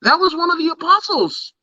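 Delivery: God in mock disbelief, pitch climbing very high; playing God. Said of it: "he's playing God".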